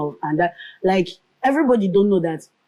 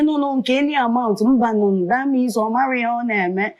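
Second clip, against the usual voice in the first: mocking employer; 6 semitones higher than usual. Employer haggling over her pay.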